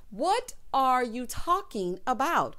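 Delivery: slow, drawn-out "What are you talking about"; slow puzzled repeat. Real, not acted. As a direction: drag it out in disbelief. Repeated slower.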